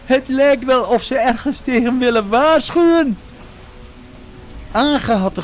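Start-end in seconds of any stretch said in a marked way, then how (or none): 0.0-3.2 high, loud, wondering call; Jojo calling. A narrator's reading. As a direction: call out over the noise of the sea. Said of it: "riep Jojo".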